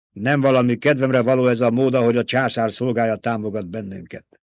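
usual speaking voice

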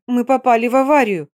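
blurting out the accident in panic; panting shaky eyewitness. Acted. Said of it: "стала сбивчиво говорить я".

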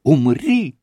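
mildly shouted dog command; hurried command shout. A narrator's reading. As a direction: call the trick command urgently. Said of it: "отчаянно спеша крикнул перезвону".

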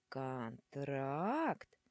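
plain reading voice

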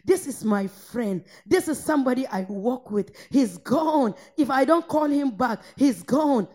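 low, soft words over a dead friend; understated, hushed grief in a retold scene; grieving Jesus. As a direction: quietly voice a mourner's sorrow. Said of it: "He looked at the body".

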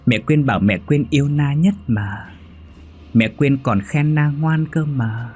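put-on high, piercing ghost-girl call; eerie little girl, endings stretched long; shrill child's voice. A narrator's reading. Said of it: "bé Na cất giọng lanh lảnh".

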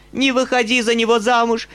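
high, loud plea with stress on Не; old woman begging the girl, loud. A narrator's reading. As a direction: beg her not to marry.

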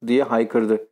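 ordinary delivery, nothing marked

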